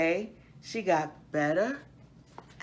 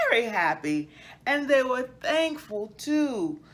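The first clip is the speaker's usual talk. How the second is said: put-on lively storybook narration for children; story reading; 5 semitones higher, 5 dB louder, slower than usual. A narrator's reading. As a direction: read it like a bedtime picture book to kids.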